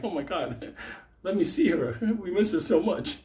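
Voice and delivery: mock-grieving visitor breaking into laughs; fake mourner. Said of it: "We're going in there like".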